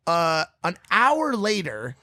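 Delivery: usual speaking voice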